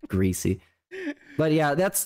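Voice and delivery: ordinary talk, nothing marked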